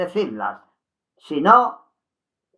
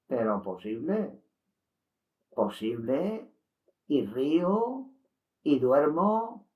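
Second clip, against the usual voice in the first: poem recital. Acted. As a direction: recite it gently and inward. Reads a classic poem.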